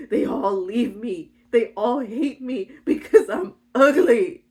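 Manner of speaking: slow, hammed-up self-pitying wail; poor little victim. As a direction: wallow in it, half laughing. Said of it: "poor little Orpheus".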